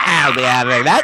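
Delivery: loud, laughing goblin voice. Retold or imitated. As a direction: growl it like a greedy goblin. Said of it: "in my goblin voice".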